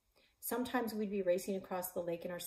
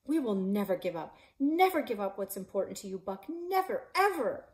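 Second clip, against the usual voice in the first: passionate plea; 3 semitones higher, 7 dB louder than usual. Acted. Pleading with dying partner.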